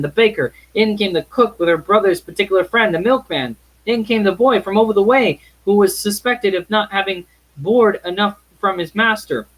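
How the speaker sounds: lively narration. A narrator's reading. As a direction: sweep the listener into the party.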